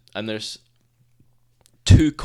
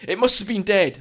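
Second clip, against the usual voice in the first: high defensive excuse; 8 semitones higher, 5 dB louder than usual. Retold or imitated. Walker's excuse quoted.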